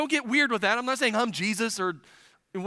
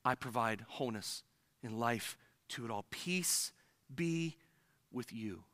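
soft and slow, each word laid down gently; gentle Jesus quote; 8 semitones lower, 8 dB quieter than usual. Retold or imitated. Pastor voicing Jesus to the congregation, soft and tender. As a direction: speak it like a tender blessing. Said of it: "Jesus is saying".

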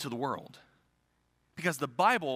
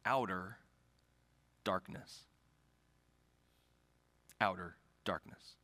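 very low, hushed pulpit delivery; grave preaching; 5 semitones lower, 3 dB quieter than usual. Real, not acted. Preacher warning his church of outer darkness, gravely. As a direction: let the words hang in long pauses. Grave sermon climax, long pauses.

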